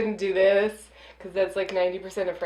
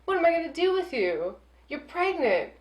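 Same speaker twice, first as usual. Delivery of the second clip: higher, softer cooing at a pet; hammed-up baby talk to a pregnant dog; mock cooing; 6 semitones higher, 5 dB quieter than usual. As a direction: coo at the dog like a fussing owner. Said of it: "She leans over and goes".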